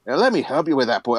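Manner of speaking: put-on light Scottish accent on an old dwarf; a touch of Scottish brogue, quick. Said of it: "why are all dwarves Scottish".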